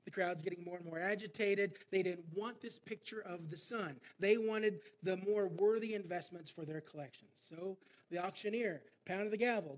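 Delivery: ordinary delivery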